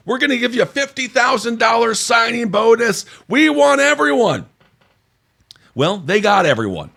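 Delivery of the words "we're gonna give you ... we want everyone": mock presidential shout, much higher and louder; Donald Trump. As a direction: ham it up like a bragging politician at a rally. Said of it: "Donald Trump was like".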